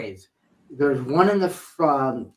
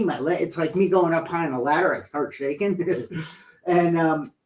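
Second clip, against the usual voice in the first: ordinary talk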